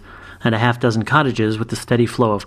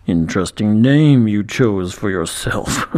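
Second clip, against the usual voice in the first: basso dragon growl; 2 semitones lower, slower than usual. A narrator's reading. Dragon knight voiced in story.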